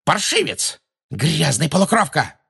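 high-pitched, venomous name-calling; outraged high cries; shrieked insults. A narrator's reading. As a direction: spit out abuse in a high voice. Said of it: "невпопад выкрикивала Белатриса".

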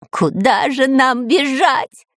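high, drawn-out mock bawl; slow, frightened wail; Дырка wailing in fear. A narrator's reading. Spy cornered by dinosaur, wailing in fear. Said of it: "ревел от страха Дырка".

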